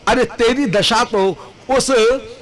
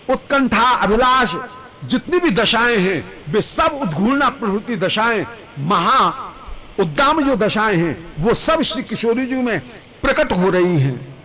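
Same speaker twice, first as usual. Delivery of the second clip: ordinary talk, nothing marked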